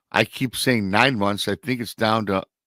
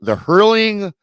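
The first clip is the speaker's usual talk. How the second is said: excited outburst; 11 semitones higher, 8 dB louder than usual. Real, not acted. Building up the cue ball joke.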